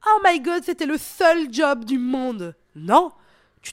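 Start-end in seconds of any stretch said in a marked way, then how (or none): 0.1-2.6 hammed-up panic, louder and higher, dragged out slowly; mock despair. Host mocking someone who lost a job, hammed-up despair. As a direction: play the overdramatic job loser, a bit slow. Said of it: "tu ne te dis pas".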